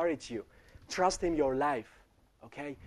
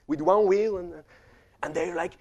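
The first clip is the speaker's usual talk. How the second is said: ordinary talk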